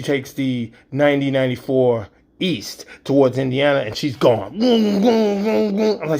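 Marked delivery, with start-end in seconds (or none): 4.6-6.0 high, loud imitated booms of speed; mock engine boom. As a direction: bang out each boom like a race car. Daughter speeding, car noise.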